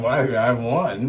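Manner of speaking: slow, drawn-out mock answer; mock reply. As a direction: drawl it like a smart reply. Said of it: "I'm like".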